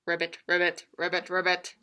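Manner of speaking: low, croaky ribbits; frog croak. Acted. Teacher voicing the frog for children, low croaking ribbits.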